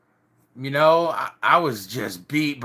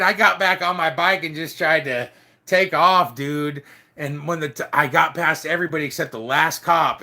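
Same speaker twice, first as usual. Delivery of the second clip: ordinary delivery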